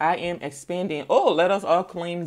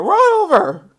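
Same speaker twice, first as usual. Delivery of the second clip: high, loud mock order; mock command; 13 semitones higher, 11 dB louder, slower than usual. Mocking the person ordering the lion, high loud command. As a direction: bark the order in mockery. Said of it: "You're talking about".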